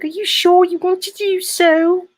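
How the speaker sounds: very high, hammed-up female English accent; squeaky My Fair Lady parody, English accent; mock English wife. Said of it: "then his wife's like".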